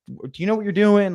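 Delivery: mock freaking out. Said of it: "he's starting to freak out".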